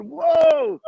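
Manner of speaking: startled whoa on hearing the news; surprised whoa. Retold or imitated. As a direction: jump up in surprise. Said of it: "And I said".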